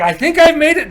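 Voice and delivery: high, loud mock triumph; triumphant announcement. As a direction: call it out proudly, up high. Braugi announces he broke through.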